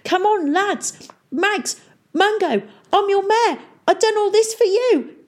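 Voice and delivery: high, loud desperate begging; sputtering appeal. A narrator's reading. Sinking mayor to his lads, high desperate appeal. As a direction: call each man in high panic.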